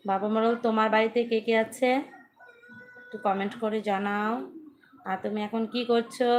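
asking loudly and clearly over a livestream. Real, not acted. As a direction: speak up so the viewer can hear. Speaks up after low-sound complaint.

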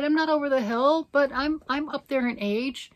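insisting she's not old, in mock protest; mock indignant. Joking about her age, emphatic repeat.

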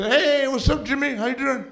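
high-pitched mom greeting; chummy mom. Retold or imitated. Mom greets her son's friend on the phone, high chummy voice. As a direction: sing out a familiar hello, way up high. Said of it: "she knows Jimmy".

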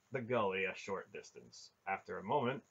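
ordinary delivery

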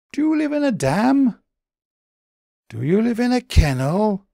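high, small puzzled bunny voice; a high, wondering little creature; Daily B's voice. A narrator's reading. Daily B asks.

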